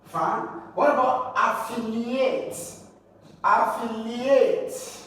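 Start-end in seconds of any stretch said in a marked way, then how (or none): 1.3-2.5 affiliate with LI raised; stress on second syllable. Shown as a demonstration. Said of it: "stress the second word syllable". 3.4-5.0 affiliate slow, LI drawn out high; deliberate teaching stress on af-FI-liate; stress on second syllable. Shown as a demonstration. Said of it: "stress the second word syllable".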